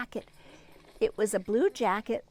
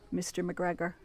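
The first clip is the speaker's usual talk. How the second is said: ordinary delivery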